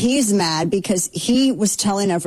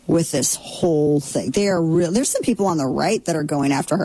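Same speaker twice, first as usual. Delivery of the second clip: ordinary delivery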